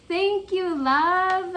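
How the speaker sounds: high, loud, gushing thank you; excited thanks. Real, not acted. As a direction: gush your thanks. Thanking the sender.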